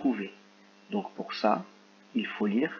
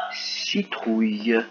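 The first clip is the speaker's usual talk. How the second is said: each syllable drawn out slowly; teacher dictating a word to first graders, slow and clear; slow dictation; much slower than usual. Shown as a demonstration. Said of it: "C'est parti".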